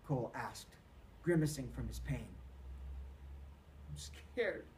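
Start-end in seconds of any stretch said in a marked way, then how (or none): 3.9-4.7 soft breathy sob; crying. A narrator's reading. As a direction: whisper it through tears. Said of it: "cried Peter".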